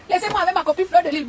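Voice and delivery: hammed-up mimicry of a rival; mocking imitation. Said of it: "elle dit que".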